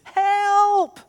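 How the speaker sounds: high, loud, desperate "help"; child's scream for help. Retold or imitated. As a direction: call out like a trapped kid. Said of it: "is, like, screaming".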